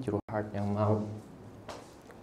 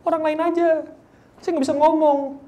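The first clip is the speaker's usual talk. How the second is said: mock reluctant Moses; 7 dB louder than usual. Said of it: "dia bilang".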